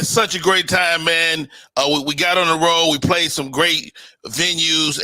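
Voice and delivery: normal talking voice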